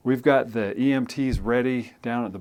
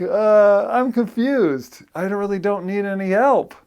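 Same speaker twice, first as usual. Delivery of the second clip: put-on confused kid; 9 semitones higher, 6 dB louder than usual. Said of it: "And he's like".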